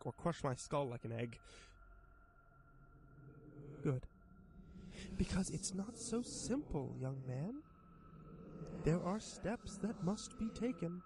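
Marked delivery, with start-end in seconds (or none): 3.8-11.0 high, slow coaxing monster voice; sly creature voice. Acted. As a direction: tempt him like a grinning demon. Creature explains rules.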